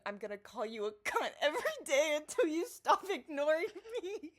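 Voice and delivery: shouted high and loud, a mock rant; hammed-up furious shout at someone ignoring him; mock angry yell. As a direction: bellow the threat like an online tirade. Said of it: "upset by me saying".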